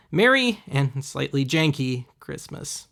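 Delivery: normal talking voice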